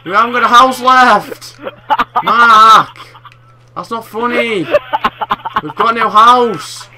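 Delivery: slow, loud, high outraged yell; furious shout. Real, not acted. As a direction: bellow in disbelief. House blown up.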